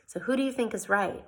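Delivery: usual speaking voice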